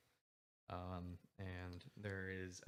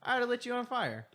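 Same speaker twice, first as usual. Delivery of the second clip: high, loud mock-friendly greeting; sarcastic high exclaim; 14 dB louder than usual. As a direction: squeak out a fake-cheery hello. GM voicing NPC, high and loud.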